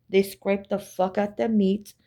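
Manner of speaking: ordinary delivery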